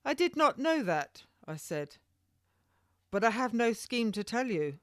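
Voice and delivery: high, shaken denial; startled protest. A narrator's reading. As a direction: plead innocence. Lady protests.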